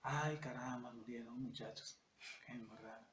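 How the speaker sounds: low, hushed mock lament, fading away; half-hearted "crying", slow and trailing off; mock weeping lament. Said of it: "llorando y diciendo".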